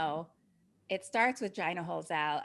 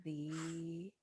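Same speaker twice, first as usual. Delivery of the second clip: hushed storyteller close; 2 semitones lower, 11 dB quieter than usual. A narrator's reading. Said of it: "closing the story".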